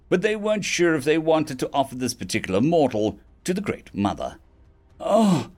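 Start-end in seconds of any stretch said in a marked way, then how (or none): 5.0-5.5 high, halting, teary voice; tearing up. Acted. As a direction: fight back drunk tears. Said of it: "tearing up".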